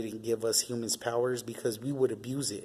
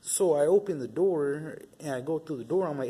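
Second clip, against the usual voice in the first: ordinary delivery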